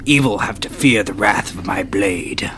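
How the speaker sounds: whispered threat; menacing whisper. Acted. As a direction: hush it like a threat. Menacing line closing stoic pose.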